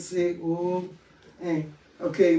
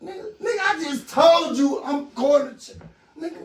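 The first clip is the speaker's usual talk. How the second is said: high retold shout, much higher; frantic yell at husband; 7 semitones higher than usual. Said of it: "I'm like".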